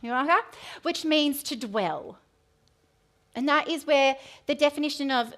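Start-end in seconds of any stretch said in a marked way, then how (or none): 0.0-0.4 quick, louder Nuaha, lifting at the end; said really fast. Shown as a demonstration. Speaker gamely tries a Hebrew word on the congregation, said really fast. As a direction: rush through Nuaha in one quick go. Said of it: "If we just say it really fast".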